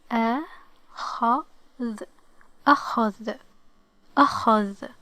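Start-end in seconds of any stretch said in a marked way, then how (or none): 0.1-1.4 the word broken into separate sounds; sounded out. Shown as a demonstration. Teacher giving the word a try, sound by sound. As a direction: pause between each sound of the word. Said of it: "let's give it a try".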